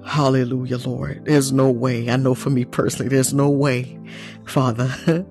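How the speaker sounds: normal talking voice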